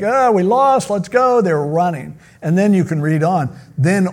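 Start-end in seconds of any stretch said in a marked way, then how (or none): 0.0-1.4 much higher, hurried mock retreat; fleeing Philistines giving up; panicked soldiers. Philistines fleeing the battle, high mock panic. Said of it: "They're like".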